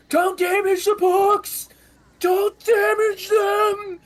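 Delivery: very high, slow, shouted plea; panicked librarian. Acted. Buried librarian shouting at the diggers, high and panicked. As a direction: shriek it, protecting the books. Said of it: "you hear a voice go".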